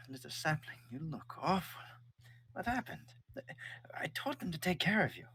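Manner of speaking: high, worried mother; mother's voice. Acted. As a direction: fret over your hurt child. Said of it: "kneels down to you and says".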